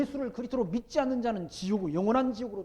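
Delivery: preaching shout. Real, not acted. Pastor warns the congregation of hell, loud preaching. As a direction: thunder the warning about eternal hell.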